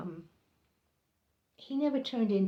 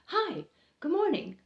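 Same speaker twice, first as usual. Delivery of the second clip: chipper coworker greeting; 10 semitones higher, 5 dB louder than usual. Retold or imitated. Coworker greeting her cheerfully.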